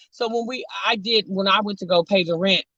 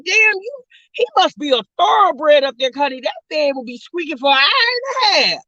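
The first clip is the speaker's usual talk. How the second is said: retold exclamation; 7 semitones higher than usual. Shocked by noisy upstairs neighbors.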